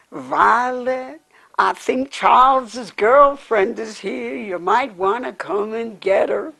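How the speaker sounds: loud, high Oklahoma drawl calling out; thick Oklahoma accent. Retold or imitated. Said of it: "in the thickest Oklahoma accent I have ever heard".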